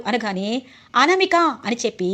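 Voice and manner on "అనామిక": raised, louder cry of the name; narrated angry call; angry outburst. A narrator's reading. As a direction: call out the name sharply, high and loud. Husband raises hand at wife.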